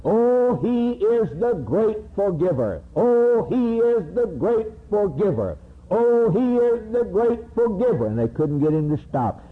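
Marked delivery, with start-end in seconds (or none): 0.0-8.0 rapturous condemned man; martyr's cry. Acted. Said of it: "he cried".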